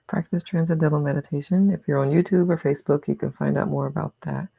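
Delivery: ordinary talk, nothing marked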